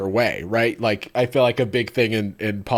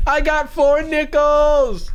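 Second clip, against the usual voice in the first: high, slow, drawn-out mock yell; imitating excited shout; 4 dB louder, much slower than usual. Host redoing the profile's line with a slip, drawn-out excited yell. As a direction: stretch it into an overexcited high holler. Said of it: "he was really excited".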